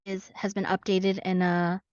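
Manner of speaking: usual speaking voice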